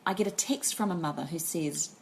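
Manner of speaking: usual speaking voice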